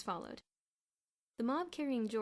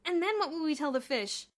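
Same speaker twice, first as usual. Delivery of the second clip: despairing wail; 9 semitones higher, 11 dB louder than usual. A narrator's reading. Boots despairs over the lost pool fund, wailing.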